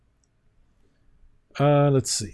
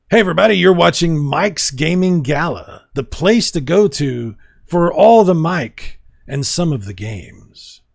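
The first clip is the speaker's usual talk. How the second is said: intro read; 3 semitones higher, 3 dB louder than usual. Shown as a demonstration. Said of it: "Or we could do".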